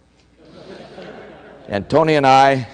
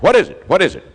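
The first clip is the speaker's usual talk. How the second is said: urgent question; 5 semitones higher than usual. Retold or imitated. Official runs over to the referee, asking in an urgent raised voice. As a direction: demand to know, fast and pressing.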